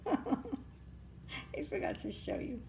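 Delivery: soft laughing whisper aside. Real, not acted. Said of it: "Oh, here he is".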